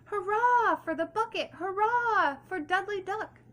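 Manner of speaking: loud, high calling out, slowing at the end; Dudley proudly announcing his return; big voice. A narrator's reading. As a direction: call it out to the whole pond. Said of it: "He called in a big voice".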